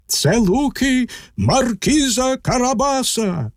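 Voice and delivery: high, drawn-out singsong announcement; slow, high chorus-like reply; reapers answering in chorus. A narrator's reading. Reapers answer the king in one voice, high chorus-like reply. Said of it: "відповіли косарі в один голос".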